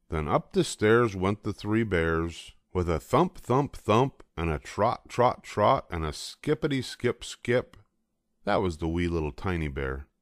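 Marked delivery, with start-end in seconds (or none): none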